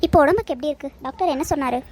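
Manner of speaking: normal talking voice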